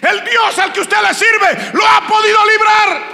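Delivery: loud, high shouted proclamation; full force preaching. Real, not acted. Preacher shouting God's deliverance to the congregation, full force. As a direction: preach it with all your might.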